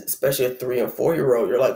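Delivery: ordinary talk, nothing marked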